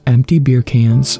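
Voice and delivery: ordinary delivery, nothing marked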